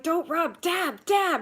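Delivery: high, loud, urgent correction; urgent mock yell. Correcting sister-in-law mid-scrub, high and loud. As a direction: stop her rubbing, loud and high. Telling her off over the stain.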